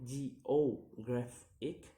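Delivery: "geographic" slowly split into syllables; slow, separated syllables on "geographic". Shown as a demonstration. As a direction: sound out "geographic" piece by piece. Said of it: "count the word syllable backwards".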